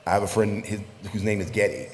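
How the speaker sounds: ordinary delivery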